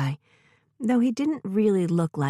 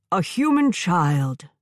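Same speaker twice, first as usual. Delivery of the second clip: slow, distant giant robot; 4 dB louder, slower than usual. Acted. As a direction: drag each word like an old machine.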